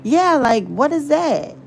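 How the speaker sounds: high, puzzled mock question; mimicking young lady. Retelling the young lady's question, high and baffled. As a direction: echo her baffled question. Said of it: "I was like".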